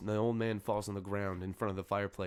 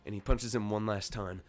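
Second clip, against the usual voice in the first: louder, punched-out reproach; angry reproach; 3 semitones higher, 6 dB louder, slower than usual. Acted. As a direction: land the first word like a blow. Varomir punches as he speaks.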